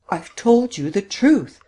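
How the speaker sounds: high, slow, drawn-out protest; insistent repetition. A narrator's reading. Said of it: "she repeated".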